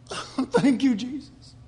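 high, relieved little thank-you; a hint of a rescued child breathing out thanks; grateful relief. Preacher acting his younger self thanking Jesus, high and relieved. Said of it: "And I go".

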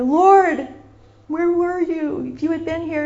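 high, loud reproachful cry; accusing. Acted. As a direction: wail the reproach at him, drawn out. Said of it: "accusing spirit".

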